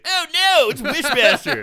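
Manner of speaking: high, loud mock panic; comic shriek of dread; mock horror. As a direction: cry out in hammed-up alarm. Acting student's shock at the monster.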